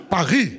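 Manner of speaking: "Paris" as paɹi, no final s; "Paris" again the French way, s silent. Shown as a demonstration. Telling of getting to "Paris", French with no s. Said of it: "You must say".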